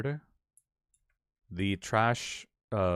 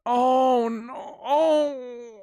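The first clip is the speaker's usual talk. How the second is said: high wailing cries of grief; wailing oh's in real distress; real crying wail; 4 dB louder, much slower than usual. Real, not acted. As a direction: cry out at the sad scene, pitched way up. Reacting to sad scene.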